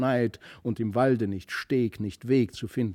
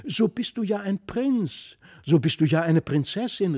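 höher, fast erschrocken ausgerufen; verwundert; 9 semitones higher, 6 dB louder than usual. A narrator's reading. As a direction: staunend und etwas erschrocken rufen. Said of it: "verwundert, ja beinahe erschrocken". (die Kinder rufen verwundert, beinahe erschrocken)

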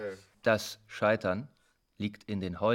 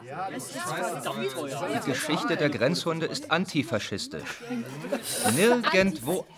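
heated declaration; 8 semitones higher, 6 dB louder, slower than usual. Acted. Speaker defends guard dogs, heated meeting.

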